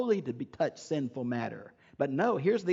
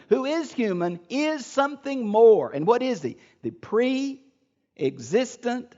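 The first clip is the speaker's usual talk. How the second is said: rising higher and louder, slowing on pre-existent; fervent preaching; 5 semitones higher, 4 dB louder than usual. Acted. Teacher proclaiming Christ's divinity to his class, fervent and building.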